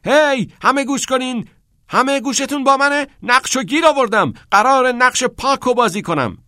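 very high, loud announcement; excited shouting. A narrator's reading. Neil tells the whole hallway he got the part, shouting excitedly. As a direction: shout to everyone, bursting with the news.

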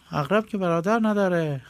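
a squeaky sidekick straining to see; high nasal lackey voice asking; Ayyub's high voice. Acted. Ayyub replies.

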